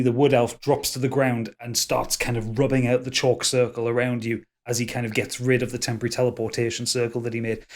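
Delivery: normal talking voice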